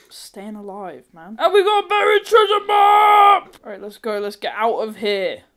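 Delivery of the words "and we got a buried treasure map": high, very loud announcement; triumphant shout. Real, not acted. Gamer finding a treasure map, triumphant shout. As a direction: belt it out in celebration.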